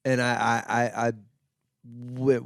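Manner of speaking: normal talking voice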